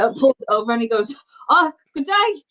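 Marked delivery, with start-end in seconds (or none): 1.5-2.4 high-pitched character goodbye; higher, brisk in-character courtesy; polite farewell. Acted. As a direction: bid good day in a much higher voice. Character bids farewell, scurries off.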